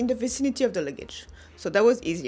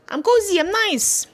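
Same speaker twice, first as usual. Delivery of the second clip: cozy and pleased; 9 semitones higher, 5 dB louder than usual. Retold or imitated. Showing bedtime comfort before kick.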